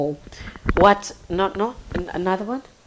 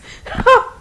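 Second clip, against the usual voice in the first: teary laugh; 7 dB louder than usual. Real, not acted. Laughs after getting emotional.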